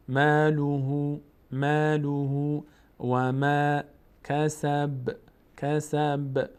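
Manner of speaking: drawn-out chanted syllables; slow recitation. Shown as a demonstration. Teacher drills the vowel sounds for students, chanted. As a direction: stretch the sounds slowly. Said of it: "recitation demo".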